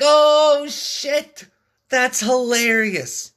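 a sudden squeal of amused shock, easing into slow laughter; startled delight. Retold or imitated. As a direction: yelp it out as the joke lands, then savour it slowly. Surprised by driver's joke.

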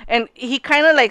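normal talking voice

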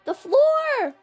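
high, loud sudden alarm; startled scream. Real, not acted. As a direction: yelp in fright. Lava suddenly fills the floor.

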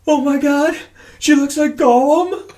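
high, loud weepy squeal; mock tearful mess. Overwhelmed dad seeing his newborn daughter, comically weepy. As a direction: imitate yourself blubbering at first sight. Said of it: "I'm just a fucking mess".

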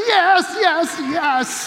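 re-enacted jubilant outburst; exuberant agreement. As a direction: celebrate each yes as if dancing. Retold joyful agreement with the lady.

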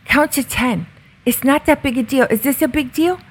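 put-on high, childlike voice of a small boy calmly soothing mom; little son. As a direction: play a little kid gently coaching his mommy to calm down. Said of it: "He goes".

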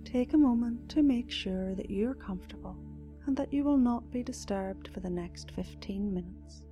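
soft, slow meditation voice; guided meditation. Acted. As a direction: guide them gently into calm.

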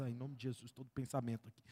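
low murmured prayer under the breath; hushed rebuke prayer with hand on head; muttered prayer. Retold or imitated. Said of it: "colocar a mão na cabeça, assim".

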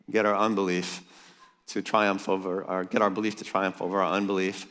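usual speaking voice

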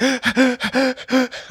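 sharp, high mock gasp for air; panicked gasp. Photographer retelling his panic, gasping in mock terror. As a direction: gasp like you can't catch your breath. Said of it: "I was, like, hyperventilating".

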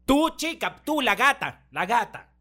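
high, loud call, fumbling the names; mock calling out. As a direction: call the kids like a flustered grandma. Imitating grandma calling wrong names. (llamado agudo y fuerte imitando)

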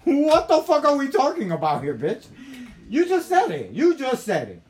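high, full-volume comic outrage; incredulous yelling. Retold or imitated. Arguing with the woman over crack, outraged shouting. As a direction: holler in disbelief at being contradicted.

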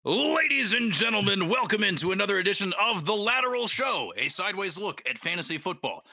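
booming show-host intro; announcer voice. Acted. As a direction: open like a ring announcer. Podcast host opening.

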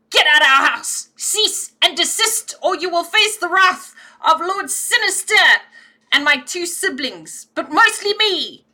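high, loud bristling kitten threat; hackles up. Acted. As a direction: puff up and warn them off. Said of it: "I'm gonna get my hackles up".

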